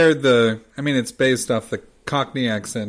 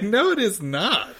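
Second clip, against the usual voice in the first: higher, haughty put-on British voice; mock British retort; 8 semitones higher than usual. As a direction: snub the American correction, pitched up. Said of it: "It's like".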